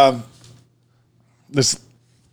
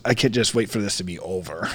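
quiet grumble; 7 semitones lower, 10 dB quieter than usual. Retold or imitated. Old lady's gloomy line.

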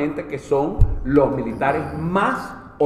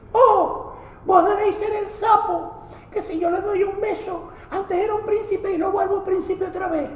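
high, sweet Maracucho princess; high dainty princess. Acted. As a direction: gush at the frog like a fairy princess. Princess speaking to frog.

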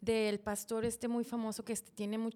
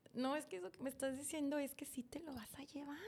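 whispered, a touch higher; whispered plea; 4 semitones higher, 7 dB quieter than usual. Retold or imitated. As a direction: whisper it to God in church. Habla con Dios en la misa. (susurro a Dios)

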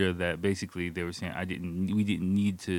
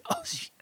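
cough then gasp; 7 dB quieter than usual. Retold or imitated. Coughing from smoke.

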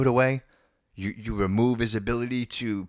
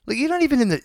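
incredulous; 4 dB louder, faster than usual. Real, not acted. Exasperated rant about candidates.

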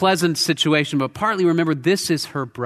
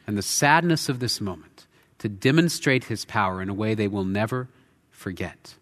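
normal talking voice

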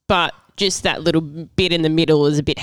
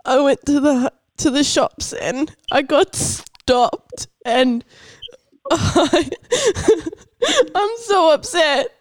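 high, distressed fake arrest call; upset; 7 semitones higher than usual. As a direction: act panicked and near tears. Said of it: "I'm gonna need your best acting".

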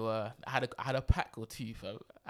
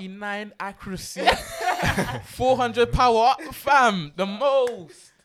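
louder and higher, laughing hype; excited hype; 6 semitones higher, 8 dB louder, slower than usual. Pretend. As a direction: hype it up like game stats. Hyping up shoe stats.

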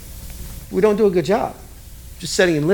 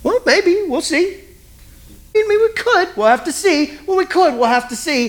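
higher hedging parent voice, stalling; noncommittal dad; 11 semitones higher than usual. Acted. Dad dodging child's requests, higher evasive stalling. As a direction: brush the kid off gently with vague maybes. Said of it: "Hey, Daddy".

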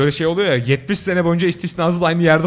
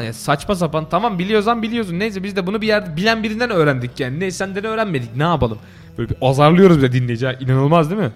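normal talking voice

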